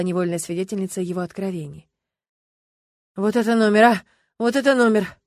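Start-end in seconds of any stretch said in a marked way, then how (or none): 3.2-5.2 stunned repetition. Acted. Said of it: "без конца повторял Олег Валерьевич".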